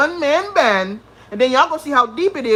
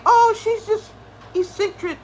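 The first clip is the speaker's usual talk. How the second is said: mocking quote; 8 semitones higher than usual. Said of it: "motherfucker saying".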